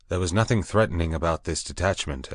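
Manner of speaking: ordinary delivery, nothing marked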